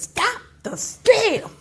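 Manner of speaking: "Stop the steal" drawn out slowly with weight on STEAL; slow, emphatic "Stop the steal", stretched to the end. Retold or imitated. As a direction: lean on each word of "Stop the steal". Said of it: "made him get some emphasis".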